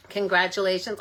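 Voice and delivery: ordinary talk, nothing marked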